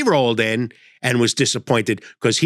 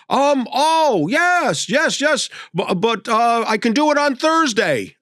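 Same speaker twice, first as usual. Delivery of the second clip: put-on eager old man; 8 semitones higher, slower than usual. Elderly driver offering Thursday.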